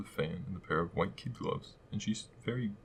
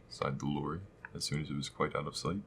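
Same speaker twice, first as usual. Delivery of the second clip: plain reading voice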